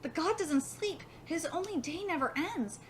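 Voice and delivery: ordinary delivery, nothing marked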